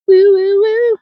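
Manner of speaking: high singsong woo-woo; string of high, silly woos; high playful woo-woo. Pretend. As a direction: toss out high musical woos. High playful sung noises after song.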